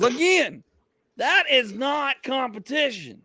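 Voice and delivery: high, drawn-out objection; slow, high comic exasperation; exasperated insistence. Acted. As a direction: insist slowly, pitched well up. Repeats objection to bar distances.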